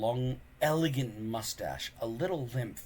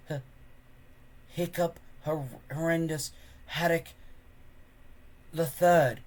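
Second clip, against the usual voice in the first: stammered; 4 semitones higher than usual. A narrator's reading. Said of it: "stammered Hiccup".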